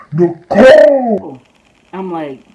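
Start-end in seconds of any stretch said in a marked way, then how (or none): 0.1-1.4 loud, drawn-out mock yell; imitated screaming. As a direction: call the name out hard, stretching it. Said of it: "she starts screaming out my name".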